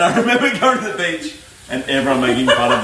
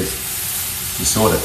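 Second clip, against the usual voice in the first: dad's mumble; 4 semitones lower, 5 dB quieter than usual. Retold or imitated. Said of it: "he said".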